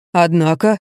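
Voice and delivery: plain reading voice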